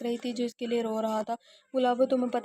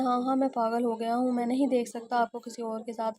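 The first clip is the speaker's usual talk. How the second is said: ordinary delivery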